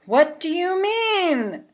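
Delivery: high, slow puzzled voice; puzzled question. Acted. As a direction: ask in honest confusion. Newcomer questions Honey.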